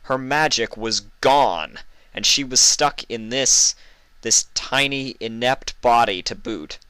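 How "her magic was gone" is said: ordinary delivery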